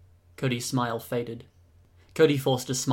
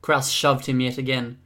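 ordinary delivery

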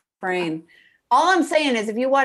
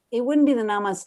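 ordinary delivery

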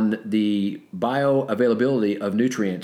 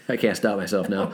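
usual speaking voice

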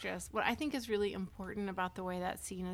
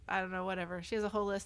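normal talking voice

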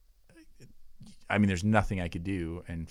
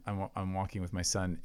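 ordinary talk, nothing marked

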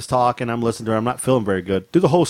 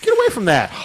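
higher, exasperated order; scolding wife; 9 semitones higher than usual. Retold or imitated. Wife pulling husband from the cooler, mock scolding. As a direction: imitate a fed-up wife.

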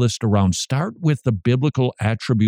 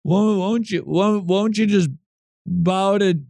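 higher, drawn-out mock begging; mock longing, stretched and pitched up; sissified boyfriend pleading; 5 semitones higher, slower than usual. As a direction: plead like a lovesick, wimpy boyfriend. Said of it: "present him as a sissified boyfriend".

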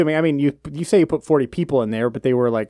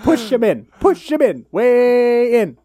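cheering on; 6 semitones higher, slower than usual. Pretend. Cheering on the stuffing.

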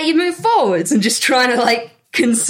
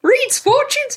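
witchy fortune-teller; 10 semitones higher than usual. Acted. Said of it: "she's a witchy cackle".

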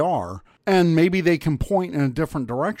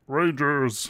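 Zordon voice; 4 semitones lower than usual. Pretend. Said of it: "most monotone, unemotional".

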